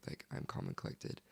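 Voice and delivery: whispered, very soft; whispered self-assurance. Retold or imitated. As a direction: breathe it out as if talking herself calm. Retelling herself calming down after prayer.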